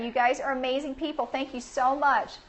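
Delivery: usual speaking voice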